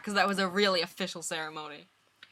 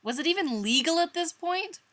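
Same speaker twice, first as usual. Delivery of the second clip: high hurt accusation; upset; 10 semitones higher, 5 dB louder than usual. Acted. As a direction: demand it, hurt.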